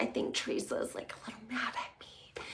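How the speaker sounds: hushed, voiceless whisper of a secret worry; retold whisper. Tamara confiding to Louis that Teresa is mad, whispered. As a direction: whisper it like you don't want her to hear.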